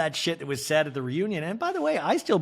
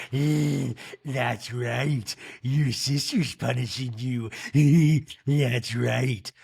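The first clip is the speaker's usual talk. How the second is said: evil demon Rinna; 2 semitones lower, much slower than usual. Retold or imitated. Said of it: "Just like an evil demon".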